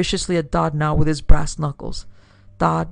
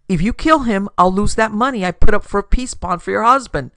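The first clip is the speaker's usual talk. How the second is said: raised, louder, insistent plea; urgent argument; 4 semitones higher, 6 dB louder than usual. Acted. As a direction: talk someone out of it, loudly. Acted urgent argument to stop killing.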